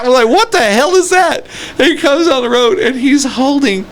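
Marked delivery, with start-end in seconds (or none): 0.3-1.4 high-pitched mock disbelief; incredulous, pitched-up question; retold bewilderment. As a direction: act out being baffled at the sight. Retelling bewildered reaction to duck.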